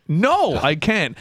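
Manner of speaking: high-pitched, insistent no; retold exasperated refusal. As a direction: refuse the bill collector, voice jumping up. Retold refusal to bill collector.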